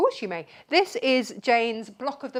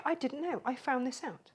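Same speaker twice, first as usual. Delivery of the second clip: ordinary talk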